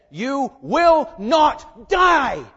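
slow, high, loud shouted denial; Satan contradicting. Retold or imitated. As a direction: drag out Satan's defiant lie. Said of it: "Satan said".

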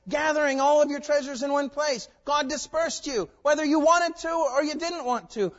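high, loud fervent preaching; preaching at full force. Real, not acted. Preacher confronts Babel's builders, forceful and loud.

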